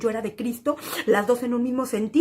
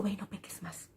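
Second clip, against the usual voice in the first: hushed retold words; 11 dB quieter than usual. Jesus's words retold softly.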